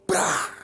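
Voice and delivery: loud snap noise. Acted. Wooden gallows snapping.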